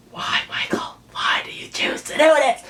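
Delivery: a breathy, broken plea to the writer; tearful whisper. Real, not acted. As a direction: whisper it, choked up, to the author. Teary plea to the author.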